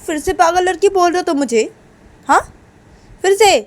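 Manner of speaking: high, loud indignant questions; acted outburst of offence; outraged challenge. Uma outraged at repeated insult.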